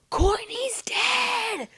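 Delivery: high, drawn-out mock wail at soft volume; retold scream. Mom screaming the news to her child, mock wail. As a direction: stretch it into a thin, strained imitation scream. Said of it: "screaming her head off".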